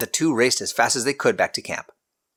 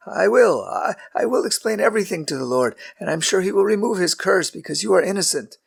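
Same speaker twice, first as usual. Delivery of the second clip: put-on lightly higher, hesitant old patriarch; a caught-out husband explaining; nervous Abraham; 10 semitones higher than usual. A narrator's reading. Abraham voiced by narrator.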